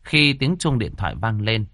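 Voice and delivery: plain reading voice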